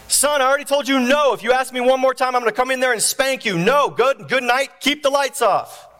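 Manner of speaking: high, fed-up scolding; dad yelling back. Acted. Father threatening his son with a spanking, yelling. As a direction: lay down the law from down the hall. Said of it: "Dad yells back".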